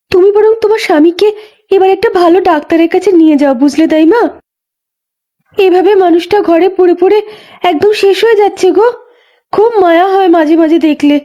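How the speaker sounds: put-on high, light young woman's voice; a pregnant young wife, lively and a bit weary; young village wife. Young Ganga tells the old midwife about the far pond, in a high young voice.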